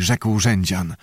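ordinary delivery, nothing marked

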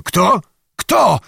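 the name hits him like a blow; sudden alarmed voice. A narrator's reading. Zagłoba hearing Bohun's name, suddenly alarmed. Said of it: "spytał nagle zgoła innym głosem".

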